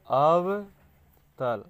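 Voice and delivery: अवतल stretched very slowly, first part held long and loud; slow, loud, syllable-split अवतल; drawn-out key term. Shown as a demonstration. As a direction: draw out अव, then let तल fall.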